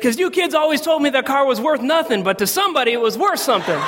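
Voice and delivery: high-pitched triumphant dad imitation; excited. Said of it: "Why are you excited about that".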